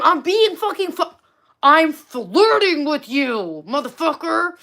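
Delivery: loud, high, hammed-up protest; mock-furious, high and drawn out; exasperated outburst. Exasperated at match who misread her.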